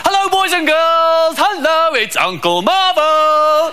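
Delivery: hammed-up children's entertainer, high and slow; Uncle Marvo. Magician greets the school audience, hammed-up high and drawn-out. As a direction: sing out a drawn-out greeting to the kids. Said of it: "Uncle Marvo gets on and goes".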